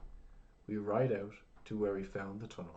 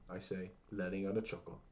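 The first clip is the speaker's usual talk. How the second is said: plain reading voice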